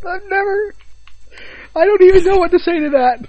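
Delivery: very high, squeaky disbelief; halting, high-pitched, lost for words; flabbergasted. Real, not acted. Friend reacting to the tea confession, flabbergasted.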